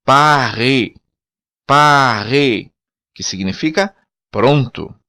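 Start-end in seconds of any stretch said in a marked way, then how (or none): 0.0-2.7 pare with a strong throaty r; Creole word. Shown as a demonstration. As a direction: say pare with a guttural back r. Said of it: "ainda mais acentuado no crioulo".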